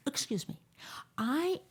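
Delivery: hushed breathy whisper. Retold or imitated. A listener asking the host a question, whispered. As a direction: lean in and whisper it.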